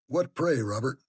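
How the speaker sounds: loud, high call from a doorway; hallooing. A narrator's reading. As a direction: halloo it out across the hall. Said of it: "hallooed Linton from the entrance".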